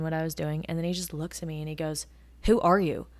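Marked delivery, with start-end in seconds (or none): 2.4-3.1 loud, demanding who are you; aggressive. Retold or imitated. Old regular loudly demanding to know who the barista is. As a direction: challenge a stranger loudly. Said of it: "Very, like, aggressively".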